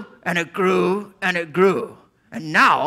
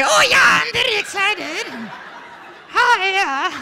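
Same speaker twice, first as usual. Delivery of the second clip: much higher, squeaky excited puppet voice. Acted. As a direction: pipe up high like a thrilled little puppet.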